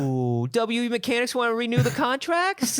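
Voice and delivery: put-on high cooing baby talk. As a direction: coo the pitch sweetly. Cooing at corporate client.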